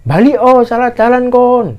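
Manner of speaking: high, loud, drawn-out holler; imitated calling out. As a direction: urge a distant hiker to turn back. Calling hiker back from wrong path. (menirukan teriakan menyuruh balik)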